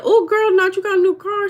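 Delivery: high, loud, sugary greeting; mock sweet greeting. Mock-sweet greeting to the neighbor about her new car. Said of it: "And so I said".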